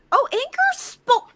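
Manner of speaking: high, slow delighted surprise; surprised. Real, not acted. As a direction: greet a lurker with a happy gasp. Streamer notices quiet viewer chat.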